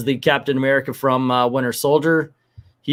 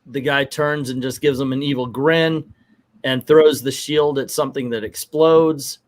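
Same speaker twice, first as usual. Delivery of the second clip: ordinary talk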